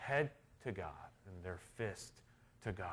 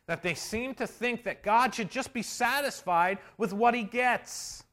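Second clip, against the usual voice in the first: loud, high fervent sermon voice; full-force preaching; 13 semitones higher, 12 dB louder than usual. Acted. Preacher driving his point home to the congregation, full force. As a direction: preach it hard to the back row.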